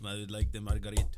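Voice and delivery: low, quick mock-Russian order; Vladimir Putin. Comic mimicking a leader ordering, low Russian accent. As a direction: do a deep, brisk Russian leader. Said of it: "like Putin just shouted out".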